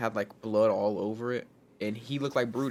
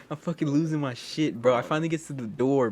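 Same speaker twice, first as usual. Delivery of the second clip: ordinary talk, nothing marked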